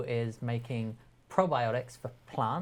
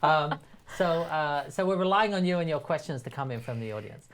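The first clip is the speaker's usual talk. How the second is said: ordinary talk, nothing marked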